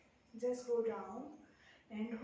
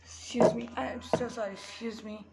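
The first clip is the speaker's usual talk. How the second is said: louder, urgent call of excuse me; loud apology; 6 dB louder, much slower than usual. Real, not acted. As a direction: call the apology out over the room. Calls out apology, interrupted class. (ज़ोर से माफ़ी माँगते हुए)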